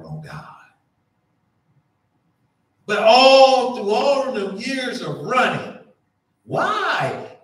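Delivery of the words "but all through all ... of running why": loud, rising sermon cry; full-force preacher shout; preaching climax. Acted. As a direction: throw it out to the pews.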